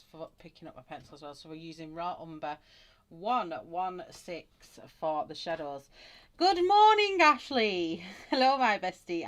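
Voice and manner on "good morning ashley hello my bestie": very loud, high, called-out hello; excited greeting. Real, not acted. Greeting a bestie joining the stream, excited shout. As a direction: call out delightedly to a best friend. Calling greeting to arriving friend.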